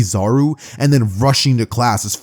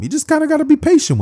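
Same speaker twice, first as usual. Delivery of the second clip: high squeaky voice. Acted.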